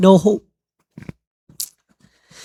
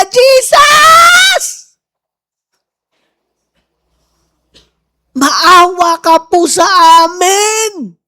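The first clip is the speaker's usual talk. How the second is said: very high, long-held plea; desperate shouting; 6 semitones higher, much slower than usual. Acted. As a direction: cry out to be heard from afar. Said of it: "Nagsisisigaw sila".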